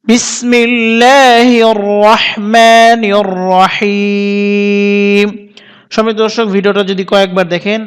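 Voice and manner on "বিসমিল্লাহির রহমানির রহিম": slow, measured melodic recitation; tartil recitation. Shown as a demonstration. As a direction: recite it with tartil, slowly and evenly. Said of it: "তারতিলের সাথে". (তারতিলের সাথে তিলাওয়াত)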